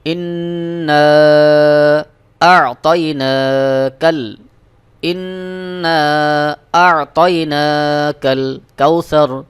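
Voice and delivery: slow melodic tajweed chant; ধীর সুরেলা তিলাওয়াত; Quran recitation. Shown as a demonstration. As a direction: draw out every vowel in recitation. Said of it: "কিভাবে মুখস্থ করবেন সেভাবে দেখিয়ে দিই".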